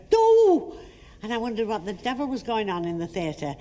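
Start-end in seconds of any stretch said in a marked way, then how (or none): 0.1-0.7 high, loud startled yelp; loud shriek. Retold or imitated. Audience member losing her teeth, high loud yelp. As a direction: let it burst out. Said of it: "I heard".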